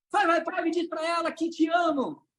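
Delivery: high, energetic crowd command; rallying shout. Shown as a demonstration. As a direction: shout the exercise to the room. Hyping the audience into exercise.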